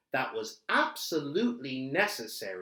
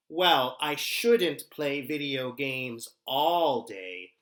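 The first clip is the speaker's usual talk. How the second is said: ordinary talk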